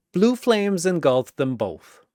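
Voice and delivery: ordinary delivery, nothing marked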